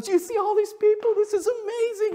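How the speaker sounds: imitating Josh's thrilled voice; excited. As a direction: shout in wonder at the crowd. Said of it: "And Josh goes".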